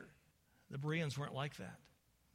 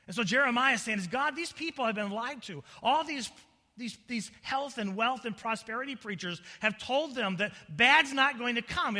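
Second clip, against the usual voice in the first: loud, raised preacher's cry; full-force preaching; 10 semitones higher, 10 dB louder than usual. Real, not acted. Preacher laments famine and dying children, at full force. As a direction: thunder it out to the congregation.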